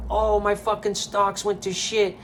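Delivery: hammed-up whining baby voice, mocking; mocking crybaby whine. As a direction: mimic a sulking baby crying over lost money. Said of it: "curl up like a little baby and bitch".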